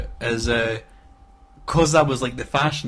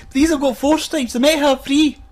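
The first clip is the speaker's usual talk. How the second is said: high, loud whining kid impression; mock-protest like a child, pitched right up; sketch kid; 13 semitones higher, 6 dB louder than usual. Acting a kid unhappy with fake trackies, sketch kid. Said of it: "and he's like".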